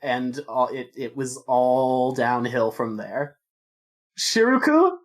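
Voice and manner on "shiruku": high, loud sing-out on entering; calling out. Acted. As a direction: call to the tailor, pitched up. Calls out on entering the tailor's.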